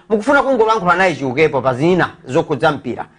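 normal talking voice

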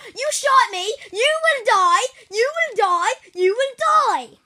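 loud high rage yells, last one slow; raging. Pretend. Gamer kid raging at the enemy who shot him. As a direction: scream vengeance at the screen.

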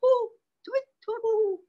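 high owl calls; owl hooting. Acted. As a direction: hoot and tweet like an owl. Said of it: "An owl says".

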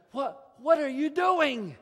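loud, slow, incredulous what; exasperated outburst. Acted. Elisha scolding the king's weak strikes, loud exasperation. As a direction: throw your hands up at the half-hearted king.

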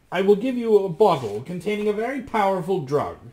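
high, loud magician's voice; Dappertutto. A narrator's reading. Magician offers Rasmus a potion, high and commanding. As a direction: declare it grandly, pitched high. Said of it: "said Dappertutto".

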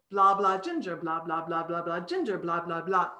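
monotone blah with Ginger popping out; only the name lands; dog-hearing babble. Shown as a demonstration. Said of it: "What does the dog hear?".

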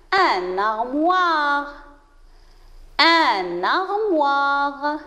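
"Un armoire" stretched at half pace; slow word model. Shown as a demonstration. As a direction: draw out every sound for pupils. Teacher models a new word. (mot modèle, très lentement étiré)

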